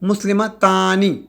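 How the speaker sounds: مسلمتانی drawn out slowly; slow demo. Shown as a demonstration. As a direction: stretch the آنی ending clearly. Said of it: "دیکھیں مسلمانی". (آہستہ ادا کیا گیا لفظ)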